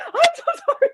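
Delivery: very high wail breaking into laughter; hammed-up high sobby sorry; mock-wailed apology. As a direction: wail it back, half laughing. Acting both wailing apologies.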